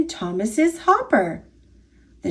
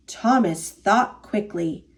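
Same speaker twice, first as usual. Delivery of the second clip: ordinary delivery, nothing marked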